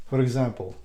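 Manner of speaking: normal talking voice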